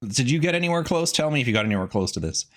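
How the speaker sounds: ordinary talk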